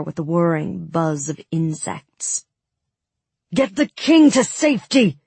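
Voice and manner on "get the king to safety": high, loud shouted order; urgent cry. Acted. Nikolai ordering guards as monsters swarm in, urgent shout. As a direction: call it out over the panic. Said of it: "Nikolai cried".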